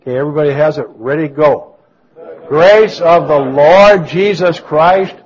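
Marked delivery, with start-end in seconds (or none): 2.5-5.2 loud, slow congregational recitation; spirited verse reading. Real, not acted. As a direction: lead the room through the verse with full spirit. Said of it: "let's read it with our spirit".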